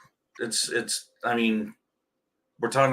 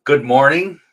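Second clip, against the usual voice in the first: cheerful greeting; 5 semitones higher, 9 dB louder than usual. Real, not acted. Upbeat show opener greeting.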